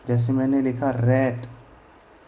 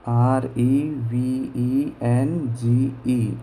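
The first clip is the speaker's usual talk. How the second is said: drawn out letter by letter; slow letter spelling; much slower than usual. Shown as a demonstration. Teacher spelling revenge slowly for the class. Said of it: "जैसे revenge".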